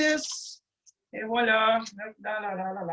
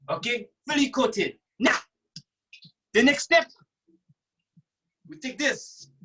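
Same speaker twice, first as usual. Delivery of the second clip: ordinary talk, nothing marked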